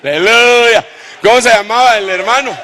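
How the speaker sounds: grita alto y fuerte, con júbilo; aleluya gritado. Real, not acted. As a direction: exclama con alegría a toda voz. Exclamación al público. (exclama aleluya ante la congregación, a gritos)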